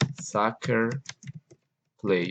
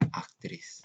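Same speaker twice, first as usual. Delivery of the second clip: whisper; 14 dB quieter than usual. Shown as a demonstration. Susurro al final de la frase.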